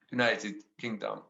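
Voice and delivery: ordinary talk, nothing marked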